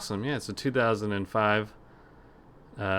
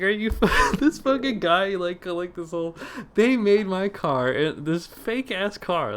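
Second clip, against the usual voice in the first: incredulous rant; 9 semitones higher, 4 dB louder, slower than usual. Real, not acted. Ranting in disbelief at scammer.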